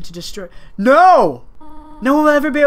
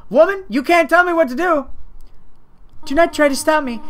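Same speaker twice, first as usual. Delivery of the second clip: loud, higher defiant talk-back; defiant retort; 7 semitones higher, 3 dB louder than usual. Acted. Gamer defying Toriel, loud and defiant. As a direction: snap back at the motherly boss.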